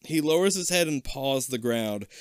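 usual speaking voice

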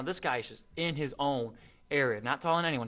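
normal talking voice

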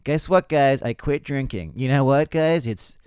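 mock proud show-off boasting to the crowd; bragging comedian. Comic mocking a sober comedian, loud proud bragging. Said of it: "mid-twenties stand-up comedian that's like".

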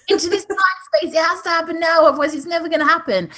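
high, hurried rush of words; mock deadline panic. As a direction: race through it in mock panic. Mocks the pre-Christmas deadline panic.

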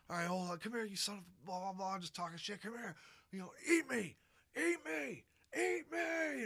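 high mock taunt, rising to a shout; taunting bug. Agent K goading the giant bug, high mock taunting. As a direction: goad the monster, voice climbing.

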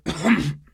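loud, sudden cough. A narrator's reading. Skivrin coughing in the middle of his dramatic tale. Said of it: "He coughed".

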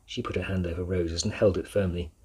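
plain reading voice

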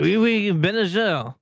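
put-on showing off his French accent to the hosts. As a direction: ham up a French phrase. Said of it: "I wanna hear everybody's French accent".

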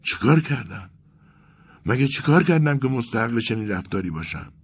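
high-pitched, plaintive self-questioning; pained mumble. A narrator's reading. Vasya asks what he did wrong, plaintive mumble. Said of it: "واسیا زیر لب گفت".

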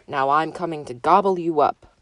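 loud, swelling troll menace; loud storybook monster; troll threat. A narrator's reading. Troll threatens second goat.

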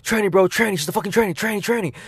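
high, urgent re-enacted alarm; frantic warning. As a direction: blurt it out to warn a buddy. Acting out alarmed warning to friend.

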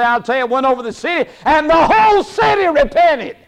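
high, loud bragging report; boastful evangelist. Retold or imitated. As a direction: crow about the results like a proud preacher. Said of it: "Like to go back and say".

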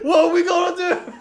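high, loud comic wail of doom; panicked wailing. Retold or imitated. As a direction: lament in hammed-up panic. Voices Barry panicking about firing.

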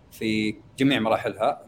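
normal talking voice

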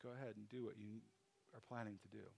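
a hushed aside to a friend at supper; whispered. Retold or imitated. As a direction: breathe it just to the one beside you. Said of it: "he can whisper it".

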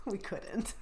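breaking into a real laugh mid-sentence; words squeezed out through laughter. Real, not acted. Guest telling host how they gave up, laughing. Cracking up while retelling the failed attempt.

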